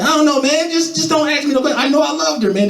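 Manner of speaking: squeaky high pleading; whiny lovesick whimper. Retold or imitated. To his friends, a squeaky high lovesick whine. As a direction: whine it out like a heartbroken teen.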